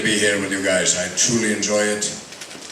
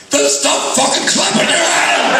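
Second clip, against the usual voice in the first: much higher, louder, slow yelled heckle; Scottish heckle shout; 4 dB louder, slower than usual. Retold or imitated. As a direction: bellow it from the back of the hall. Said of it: "someone went".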